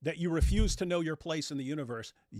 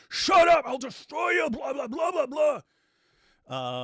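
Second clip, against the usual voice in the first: much higher, much louder mock shouting; mock furious outburst; 12 semitones higher, 9 dB louder, slower than usual. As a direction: blow up like a bully shutting down a critic. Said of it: "and if you even slight".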